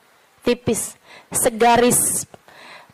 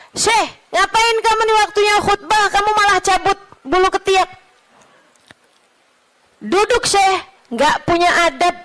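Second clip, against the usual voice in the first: higher, indignant mock rebuke; indignant scolding; 9 semitones higher than usual. Scolding a syekh for plucking during the khutbah, mock indignant. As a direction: act out a reproach.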